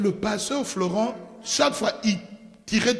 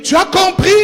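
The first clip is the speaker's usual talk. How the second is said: emphatic question; 10 semitones higher, 8 dB louder than usual. Retold or imitated. Pastor's emphatic question retold.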